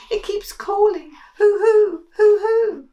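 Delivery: high loud frightened hooting; high shrill hoo of fear; scared owl imitation. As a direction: panicky mouse mimicking the owl.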